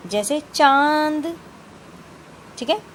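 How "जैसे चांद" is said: चांद said slowly and raised, with a nasal vowel; nasal example word. Shown as a demonstration. Teacher to pupils, showing the nasal vowel slowly. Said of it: "ये इसका चिन्ह है".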